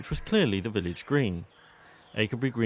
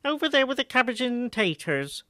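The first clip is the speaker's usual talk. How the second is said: much higher rustic woman's voice; village postmistress; 14 semitones higher than usual. A narrator's reading. Postmistress Carrie directs her cousin to the vegetables, high rustic voice. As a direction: point her to the veg, matter-of-fact. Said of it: "said Carrie".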